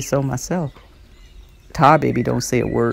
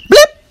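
high loud popping blip; blip sound; 12 dB louder than usual. A narrator's reading. Narrator sounding the fist sticking, high loud blip. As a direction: pop it high and sharp.

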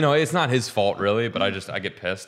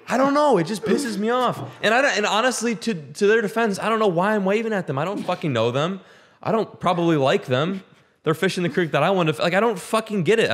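worked-up rant; 8 semitones higher than usual. Real, not acted. Ranting about waving.

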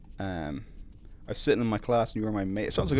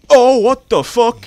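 shocked yell; 12 semitones higher, 15 dB louder, slower than usual. Real, not acted. Monster attacks.

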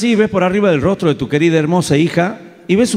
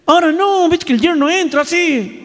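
much higher, exasperated exclamation; indignant outburst; 12 semitones higher than usual. Real, not acted. Comedian ranting at the audience about sons-in-law, high and indignant. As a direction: burst out high with comic outrage.

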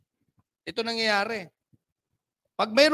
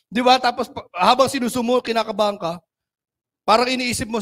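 normal talking voice

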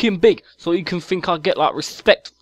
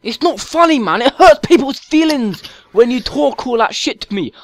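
whiny hurt chav; 6 semitones higher, 4 dB louder than usual. Acted. Chav character acts hurt.

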